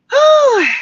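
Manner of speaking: high whooshing whew of relief; a real loud sigh of relief; relieved whew. Real, not acted. Relief after a rough day.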